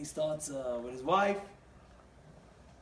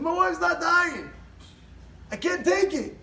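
high, loud, stretched wail; exasperated acted holler; desperate complaint; 10 semitones higher, 5 dB louder than usual. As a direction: cry out at the end of your rope. Desperate husband complains to Rebbe.

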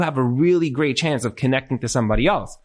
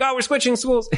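pitched up high, airy and dismissive; breezy dodge; 9 semitones higher than usual. Retold or imitated. Parent to the Rebbe, a high breezy brush-off. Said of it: "And you're like".

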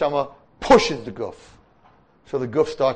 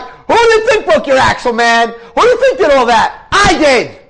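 much higher and louder, taunting Satan; gloating Satan; 8 semitones higher, 8 dB louder than usual. Acted. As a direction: crow over him like a victorious devil. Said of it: "the Satan says".

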